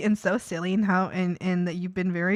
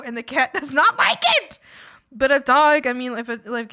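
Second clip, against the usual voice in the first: usual speaking voice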